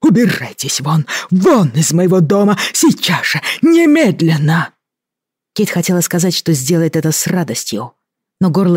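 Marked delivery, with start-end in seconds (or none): 0.0-4.7 furious order. A narrator's reading. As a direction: drive her out, raging. Governor throws her out. (яростно выгоняет)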